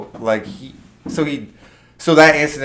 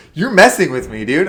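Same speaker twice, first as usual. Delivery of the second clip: higher, louder, half-laughing; excited realization; 6 semitones higher, 6 dB louder than usual. Retold or imitated. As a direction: catch on to the prank. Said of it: "I was like".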